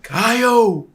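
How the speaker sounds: high, loud pleading call; shouting over wind. Retold or imitated. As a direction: yell to a friend over the wind. Pleading with exhausted friend, shouting.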